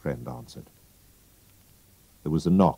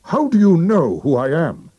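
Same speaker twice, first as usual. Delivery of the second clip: much higher and louder alarmed demand; alarmed outburst; 12 semitones higher, 7 dB louder than usual. Acted. Unmasked king demanding how Holmes knew, loud alarm. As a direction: burst out in shock.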